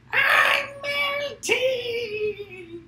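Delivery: very high, slow drawn-out witch wail; Wicked Witch of the West. Retold or imitated. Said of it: "sound like the Wicked Witch of the West melting".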